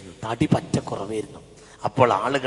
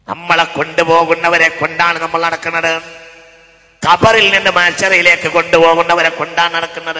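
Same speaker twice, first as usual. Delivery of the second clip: loud sermon on a level chanting note; intoned preaching; 6 semitones higher, 14 dB louder than usual. Acted. Preacher warning the congregation about the grave, intoned preaching. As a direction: project it to the whole hall.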